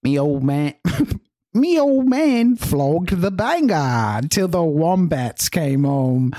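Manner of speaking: slow, high, hammed-up Aussie accent; Australian accent. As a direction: drag out the mock Australian vowels. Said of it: "in your best Australian accent".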